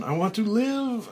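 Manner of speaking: high, loud plea to live; desperate cry. Acted. Frank under falling dirt, high loud plea. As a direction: beg for your life.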